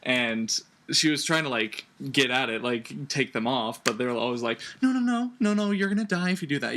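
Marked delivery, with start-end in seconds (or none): 4.8-6.8 high-pitched, hurried imitation of worried friends; mock frantic warning. Characters' frantic warning, acted out.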